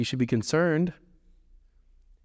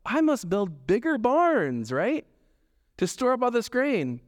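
eager rich farmer; 7 semitones higher than usual. Retold or imitated. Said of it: "his first thought is what?".

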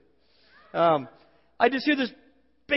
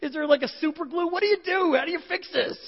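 high, louder frantic questions; mock panic; 11 semitones higher, 4 dB louder than usual. Husband imagines his baby's head popped off, frantic high mock panic. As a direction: spiral into comic panic. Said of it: "And I'm just like".

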